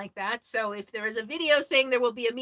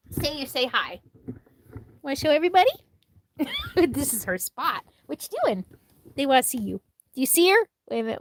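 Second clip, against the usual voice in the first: higher, louder doting pet talk; talking to the dog; 3 semitones higher, 7 dB louder than usual. Real, not acted. Showing her dog Sadie to viewers, higher doting pet talk. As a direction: coo at your dog for the camera.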